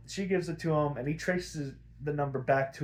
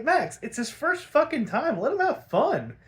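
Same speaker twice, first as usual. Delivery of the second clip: exasperated scolding; 11 semitones higher, 4 dB louder than usual. Retold or imitated. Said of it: "and I'm just like".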